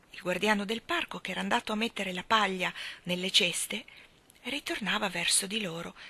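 ordinary delivery

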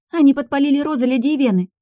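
put-on high, breathless young maid. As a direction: rush it out like a flustered maid. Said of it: "запыхавшаяся Лара".

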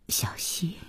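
very soft, whispered query; hushed, tentative asking; careful question. A narrator's reading. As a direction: ask the name cautiously, barely voiced. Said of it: "小心地问".

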